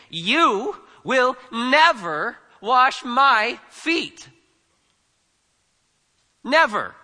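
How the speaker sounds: "never" punched high and loud; very slow, each word of "You will never wash my feet" hit hard; emphatic refusal. Acted. Peter refusing to let Jesus wash his feet, loud, slow, emphatic "never". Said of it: "Emphatic again".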